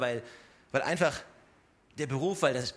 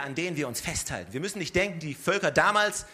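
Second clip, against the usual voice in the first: ordinary talk, nothing marked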